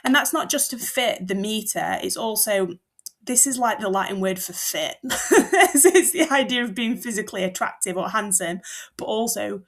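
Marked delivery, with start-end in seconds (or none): 5.0-5.8 laugh. Real, not acted. Teacher laughs at joke.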